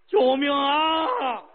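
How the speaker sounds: slow wailing cry half laughing; near tears. Acted. Terrified king cries for help before the giant consort. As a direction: wail for help almost sobbing. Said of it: "齐宣王差点哭了".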